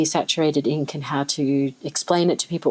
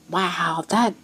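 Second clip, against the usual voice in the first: ordinary delivery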